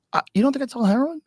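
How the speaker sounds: high, fast incredulous question; mock incredulous government. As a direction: play the shady official, amazed. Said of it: "a little horsey horse".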